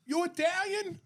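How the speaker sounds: very high, incredulous squeal; Providence Italian guy. Retold or imitated. Providence man discovers a fellow Italian, high mocking impression. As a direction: pitch way up like a thrilled countryman. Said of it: "That's what he said".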